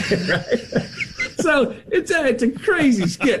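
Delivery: high laughing through the words. Real, not acted. [name] laughs telling the hosts his story. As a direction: laugh while you get it out.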